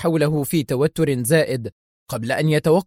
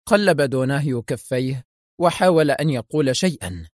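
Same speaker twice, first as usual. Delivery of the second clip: usual speaking voice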